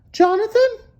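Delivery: mother finding the mess, alarmed; mother calling out. A narrator's reading. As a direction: call him like a shocked mom. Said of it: "she said".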